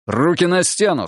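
sharp police command; commanding order. A narrator's reading. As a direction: order him to the wall. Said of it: "скомандовал он Олегу".

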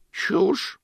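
put-on high, slow, cracked old lady. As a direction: croak like a tiny ancient aunt. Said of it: "послышался старый голос".

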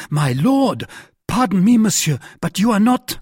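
high, loud flustered apology; startled outburst. A narrator's reading. D'Artagnan realizes he faces a duke, loud and flustered. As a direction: blurt it out in sudden alarm.

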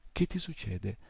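usual speaking voice

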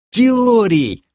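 loud, high cry to the beast; yell. A narrator's reading. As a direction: yell the name in urgent alarm. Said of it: "завопил я". (громкий высокий вопль)

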